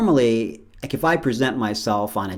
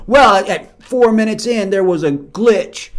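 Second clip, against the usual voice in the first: higher, louder, pointed voice; nitpicking executive; 8 semitones higher, 9 dB louder than usual. Retold or imitated. Executive pointing out a glitch in the edit, in a higher, louder fussy voice. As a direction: play a fussy critic finding fault.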